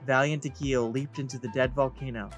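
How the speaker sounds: usual speaking voice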